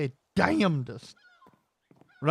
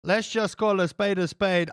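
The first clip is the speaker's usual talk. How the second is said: ordinary talk, nothing marked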